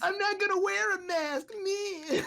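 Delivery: hammed-up whiny complainer; whiny accent. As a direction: whine like a spoiled brat. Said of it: "I can do a bitch accent".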